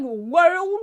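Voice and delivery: "world" in a slow, high mock Oz Cobb accent; amused go at the Oz Cobb accent; Oz Cobb accent attempt. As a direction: stretch "world" into Oz Cobb's accent, half laughing. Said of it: "that accent is impossible".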